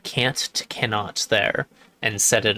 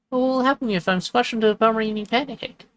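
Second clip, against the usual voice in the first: higher, fretting little dog; lightly voiced worried dog; 11 semitones higher, faster than usual. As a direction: squeak out the worry.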